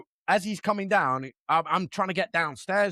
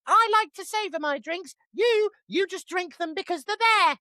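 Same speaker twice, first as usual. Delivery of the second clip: mock wife's voice; 11 semitones higher than usual. Telling husband off over his Red Bull, in a high mock voice. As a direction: lift the pitch and lecture like the wife. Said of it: "She's like".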